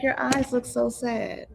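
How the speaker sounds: soft, slow, a hint of a little girl; lightly voiced daughter; child's gentle voice. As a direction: say it softly like a young child noticing. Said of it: "And she's like".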